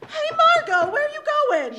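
loud high call after her. Acted. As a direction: call after her as she walks away. Calling after friend who walks off.

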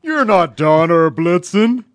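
slow, loud, put-on Santa voice; Santa impression. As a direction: boom like a grumpy Santa.

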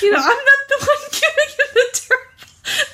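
high-pitched talking through laughter; laughing. Real, not acted. Laughing at the witch impression.

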